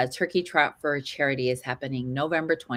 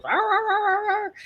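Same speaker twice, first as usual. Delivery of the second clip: high-pitched mock barking; imitated dog barking. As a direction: imitate a playful dog talking back. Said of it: "she just goes".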